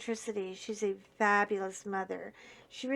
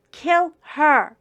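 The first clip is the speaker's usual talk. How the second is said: Kill her yelled higher and much louder; shouted order; 6 semitones higher, 13 dB louder than usual. Retold or imitated. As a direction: bark Kill her as the gunman. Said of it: "looks at the other gunman and says".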